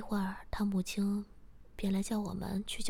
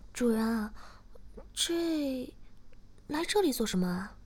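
curious; 4 semitones higher than usual. A narrator's reading. Shiya asks why they came here, curious and puzzled. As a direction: wonder aloud, stretching the hesitation. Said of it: "诗雅好奇地说".

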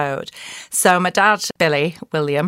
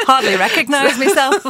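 laughing through the words, higher and louder; 7 semitones higher, 5 dB louder than usual. Real, not acted. Guest laughing off the host's flattering welcome, amused laugh. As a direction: giggle it out, embarrassed by the praise.

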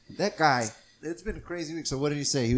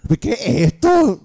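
high, loud, slow mock outburst; Puerto Rican lady freaking out; 10 semitones higher, 9 dB louder, slower than usual. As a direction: yell it like a furious Puerto Rican mom. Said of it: "just a Puerto Rican lady freaking out".

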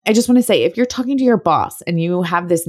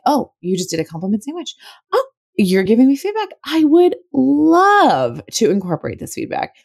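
delighted; 7 semitones higher than usual. Retold or imitated. Imagined delighted reply to boss.

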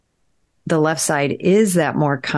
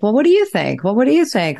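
lightly acted asking, pitched up; consulting inner voices; 6 semitones higher, faster than usual. Asking the other brain areas, lightly acted inquiry. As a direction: toss the question out higher, lightly played. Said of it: "hear from these other areas".

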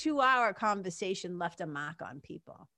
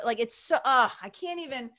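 ordinary talk, nothing marked

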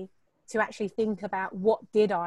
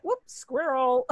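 mock distraction; 4 semitones higher, slower than usual.

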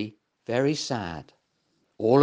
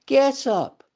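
higher pleading little dog voice, slowing down; begging; 10 semitones higher, 4 dB louder, slower than usual. Acted. Little dog begging Get Up to come home. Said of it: "begged Little Mutt".

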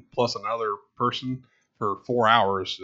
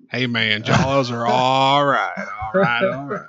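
slow, laid-back Texan drawl, louder on the all rights; Matthew McConaughey impression; 5 dB louder, slower than usual. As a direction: lean back and stretch out every all right. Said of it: "went full Matthew McConaughey there".

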